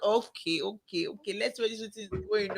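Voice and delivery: soft, guarded okays trailing down; wary acceptance. Retold or imitated. Worker humouring a gushing customer to see where it goes, wary acceptance.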